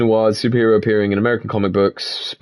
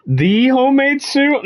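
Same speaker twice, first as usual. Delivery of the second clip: incredulous question; 12 semitones higher, slower than usual. Real, not acted. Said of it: "I think you'll find it's one of the homemade suits".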